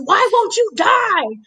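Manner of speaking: mild mock yell. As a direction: wail it like a frustrated villain. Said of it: "he screams".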